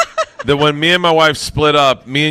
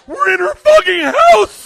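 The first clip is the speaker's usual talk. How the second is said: high, slow, loud disbelief; high slow shouted disbelief; amazed shout; 5 dB louder, slower than usual. Retold or imitated. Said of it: "I was like".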